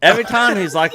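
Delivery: ordinary talk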